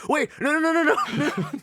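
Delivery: high, loud mock-panicked squeal of protest; hammed-up pleading; panicking prey animal. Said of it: "the mouse or the chicken is like".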